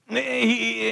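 ordinary talk, nothing marked

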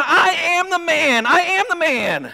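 high, excited cry, jumping for joy; excited insistence. Acted. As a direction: shout it out, thrilled to be seen. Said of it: "man's jumping up and down".

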